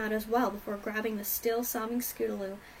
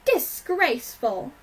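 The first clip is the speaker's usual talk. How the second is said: loud, slow indignant exclamation; angry; 3 semitones higher, 8 dB louder, slower than usual. A narrator's reading. Rarity hearing of the beating, angrily indignant. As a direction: draw the word out in outrage. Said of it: "said Rarity angrily".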